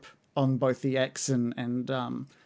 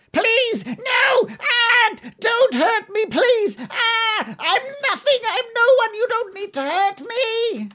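very high, shrill, crying goblin plea; a broken goblin begging for mercy; desperate cracking pleading; 6 dB louder, much slower than usual. Acted. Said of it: "and he's just like".

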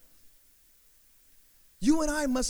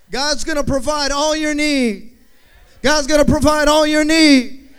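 preaching at full force; 7 dB louder than usual. Acted. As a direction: hammer it home louder each time.

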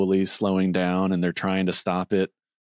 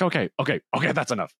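flustered Grinch; 7 semitones higher, much faster than usual. Retold or imitated. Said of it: "and then he's like".

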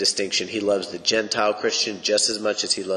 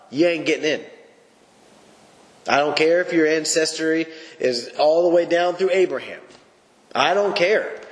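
preaching at full force; 6 semitones higher than usual. Acted. Pastor warning the congregation that only Jesus gets them in, preaching at full force. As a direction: preach it hard, rising to a peak.

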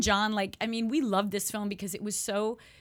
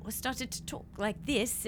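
ordinary talk, nothing marked

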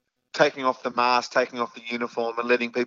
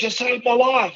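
retold shout; 13 semitones higher than usual. Runner repeating his thanks to Gus, high mock shout. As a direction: shout it after him as he runs by. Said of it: "he just shouted out".

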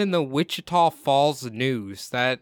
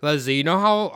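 ordinary talk

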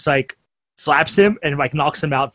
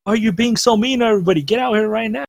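higher-pitched mock yell, not full volume; mock scream; 7 semitones higher than usual. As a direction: imitate an angry yell, held back in loudness. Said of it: "he just starts screaming".

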